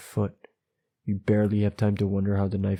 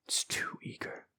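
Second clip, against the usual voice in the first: whisper; 13 dB quieter than usual. Acted.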